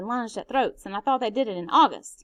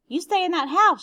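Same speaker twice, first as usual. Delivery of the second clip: loud, higher yell of warning; 7 semitones higher, 6 dB louder than usual. Acted. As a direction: yell the order at the children. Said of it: "she yelled".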